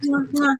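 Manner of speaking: high smacking air kisses; blowing kisses. Real, not acted. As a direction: kiss the air twice.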